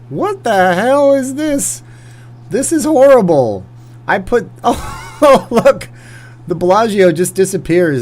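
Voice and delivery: high, loud disbelieving cry; shocked outcry. Real, not acted. Gamer reacting to a sandstorm, shocked high outcry. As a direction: squeal out at the mess on screen.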